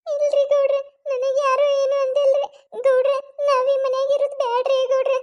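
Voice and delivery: crying wife begging her husband to leave the house; tearful pleading. Acted. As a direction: whimper and beg him to move out. Wife still weeping, pleading.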